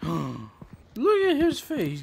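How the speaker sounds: gasping, slow and high; delighted gasp. Real, not acted. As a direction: gasp in delight at his face. Gasp at cute face.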